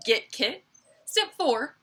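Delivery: normal talking voice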